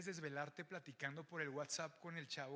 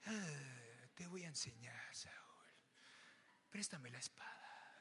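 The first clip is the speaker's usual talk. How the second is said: soft whispered wise master; Miyagi-style whisper; 5 semitones lower, 9 dB quieter, slower than usual. Retold or imitated. [name] as Mister Miyagi to Saúl, hushed whisper. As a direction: whisper it like an old sensei. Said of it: "como el maestro del Karate Kid".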